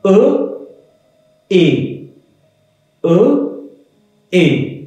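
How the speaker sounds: plain e then é, alternating; French é contrast. Shown as a demonstration. Teacher demonstrating French e then é. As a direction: contrast French e and é, slowly, one by one. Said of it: "जब हम accent लगा देते हैं तो बनेगा ए".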